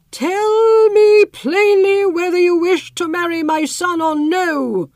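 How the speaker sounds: high, slow, stately queen voice; a queen pressing for a straight answer, drawn out; queen's demanding question. Acted. Queen to Sittukan, high and slow.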